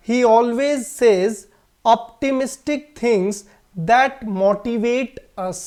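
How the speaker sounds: each word read out very slowly; slow example sentence. Shown as a demonstration. Teacher reading an example sentence slowly to students. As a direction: dictate the English sentence slowly and clearly. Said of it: "sentence देखिए".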